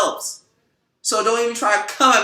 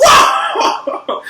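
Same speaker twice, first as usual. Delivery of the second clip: high, loud shocked yelp with a laugh; startled shout; 9 dB louder than usual. Retold or imitated. Reacting to the guy yelling on the bus with a high startled laughing shout. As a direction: jump back in mock alarm. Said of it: "I'm like".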